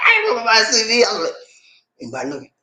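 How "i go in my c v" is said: high, hammed-up bawling; mock crying. Man hiding in the closet to cry, mock bawling. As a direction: wail it in a comic fake cry. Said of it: "When I feel like I wanna cry".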